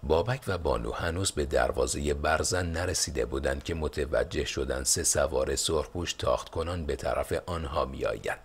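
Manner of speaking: usual speaking voice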